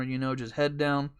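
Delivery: usual speaking voice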